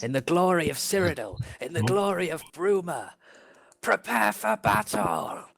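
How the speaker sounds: rallying speech, measured at first, climbing to a high cry; yelled battle speech. Acted. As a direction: build steadily from solemn oratory to a battle cry. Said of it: "I, I yell".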